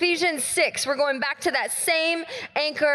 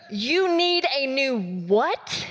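drawn out, each word slow; much slower than usual. Retold or imitated. Pastor asking friend to repeat, slowly. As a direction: ask it again very slowly. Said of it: "say it slower for me".

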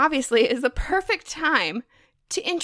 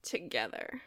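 low, soft ironic aside; hushed, doubtful quote-marks delivery; air quotes; 11 semitones lower, 15 dB quieter than usual. Real, not acted. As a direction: drop it in quietly, in scare quotes. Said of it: "Air quotes".

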